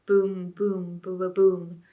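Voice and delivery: booming drumbeats voiced; drum imitation. A narrator's reading. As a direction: beat out the drum with your voice.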